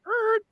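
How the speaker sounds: a sudden high yelp of hesitation; startled er. Retold or imitated. Telling how he froze passing the actor, a high startled er. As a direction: squeak out a startled er.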